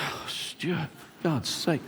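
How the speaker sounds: breathy whispered groan; whispered complaint to the mentor; exasperated whisper. Retold or imitated. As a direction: sigh it out, fed up. Groaning at new challenge.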